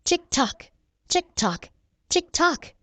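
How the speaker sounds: crisp, spaced tick-tock; storybook clock sound. A narrator's reading. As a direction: imitate a clock ticking, each pair set apart. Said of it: "he heard a familiar sound".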